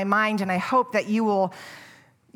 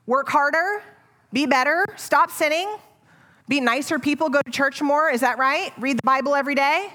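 higher, louder, brisk rattled-off demands; mock checklist; 4 semitones higher, 4 dB louder than usual. Preacher mocking the rules-list view, higher and louder. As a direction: rattle off chores sarcastically.

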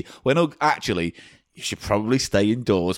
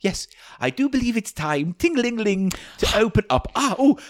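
high, hammy sinister shopkeeper with ooh and ah; creepy shopkeeper voice; 9 semitones higher than usual. Acted. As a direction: ham up a creepy sweet-shop owner welcoming customers. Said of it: "Mister Tim does a different character".

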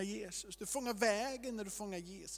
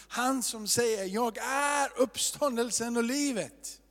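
rising, louder, slow preacher's call; preaching at full force; 4 semitones higher, 5 dB louder, slower than usual. Acted. Pastor calling his flock to wrestle with Jesus, impassioned preaching. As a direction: lift the congregation with each "Jag är". Preacher builds to a rousing climax.

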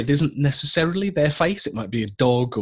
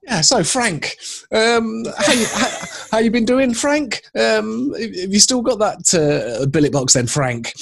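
high, louder, overeager chat that hammers the name Frank; overusing the name; 9 semitones higher, 3 dB louder than usual. Retold or imitated. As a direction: cram the name in every line, pitched high and keen. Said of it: "it's like".

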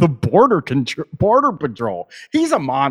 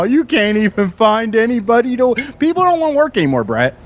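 mocking; 6 semitones higher, faster than usual. Mocking the clip guy's complaint.

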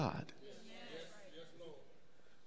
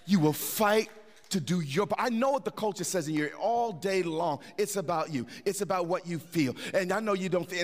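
normal talking voice

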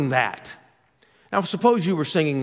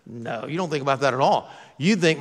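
normal talking voice

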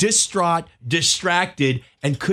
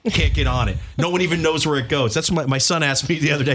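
ordinary talk, nothing marked

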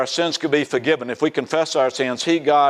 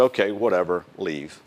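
dismissive brush-off; 9 semitones lower, 4 dB quieter than usual. Retold or imitated. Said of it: "was getting irritated".

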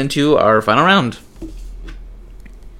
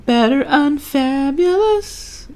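very high, slow stretched-out title; mock singsong; 3 dB quieter, much slower than usual. Joking about her old show, high and slow.

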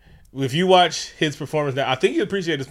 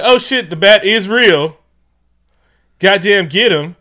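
loud, high mock-alarmed cries; crowd's alarmed shout; 8 semitones higher, 12 dB louder, slower than usual. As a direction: shout it like a stunned onlooker. Acting out startled crowd's reaction.

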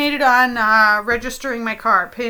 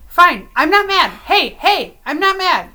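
angry insistence; 4 semitones higher, 7 dB louder than usual. Acted. Insists she is not mad.

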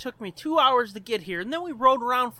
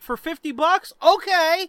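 eager; 7 semitones higher, 8 dB louder than usual. Retold or imitated. Engineer cheerfully agreeing.

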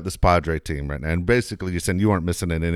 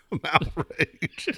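higher, very slow put-on outrage with a laugh; mock outrage; 9 semitones higher, 4 dB quieter, much slower than usual. Co-host echoing mock outrage, laughing and slow. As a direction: echo the fake outrage, laughing. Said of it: "You sound like John Butler right there".

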